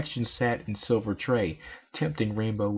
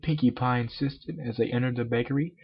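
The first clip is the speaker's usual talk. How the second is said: usual speaking voice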